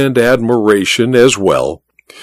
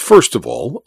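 ordinary delivery

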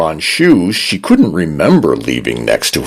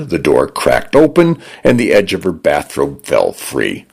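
ordinary delivery, nothing marked

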